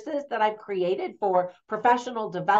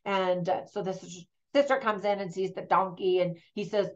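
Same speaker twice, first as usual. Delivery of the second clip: ordinary talk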